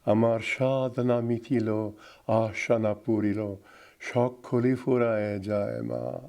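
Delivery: low, soft, gently recited Bengali line; sweetly intoned devotional verse. Shown as a demonstration. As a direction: recite tenderly, level and unhurried. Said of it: "but it's so sweet".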